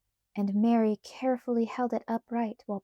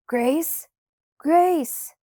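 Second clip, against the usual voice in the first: loud calls building higher; shouting; 6 semitones higher, 6 dB louder than usual. A narrator's reading. Pa shouts for his lost daughter, building louder. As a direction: shout the name, harder the second time. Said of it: "He shouted".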